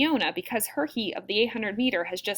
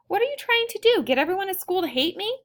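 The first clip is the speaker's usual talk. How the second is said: high-pitched, appalled questioning; teen daughter confronting her dad; exasperated protest; 9 semitones higher than usual. A narrator's reading.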